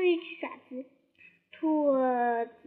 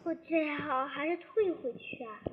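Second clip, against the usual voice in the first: usual speaking voice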